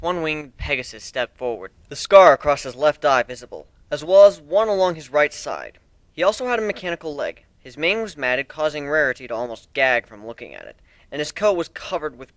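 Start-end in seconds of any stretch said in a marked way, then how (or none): none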